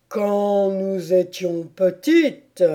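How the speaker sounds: slow, heavy storytelling turtle; tortue mélancolique. A narrator's reading. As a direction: draw the memories out slowly. (voix lente de tortue mélancolique)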